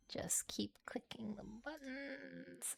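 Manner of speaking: slow whisper to herself. Real, not acted. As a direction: breathe it out slowly, barely voiced. Muttering to herself while clicking.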